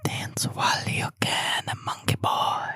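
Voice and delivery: slow, breathy whisper; whispered quote. Pretend. Co-host teasing his friend with the movie line, whispered slowly. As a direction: hush it like a creepy secret.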